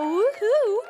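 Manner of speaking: loud, high, drawn-out whoop; playful cheer. Pretend. Host cheers Austin's live music, playful whoop. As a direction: let out a celebratory woohoo.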